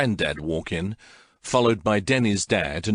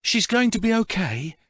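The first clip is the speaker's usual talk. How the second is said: ordinary delivery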